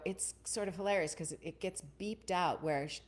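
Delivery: ordinary talk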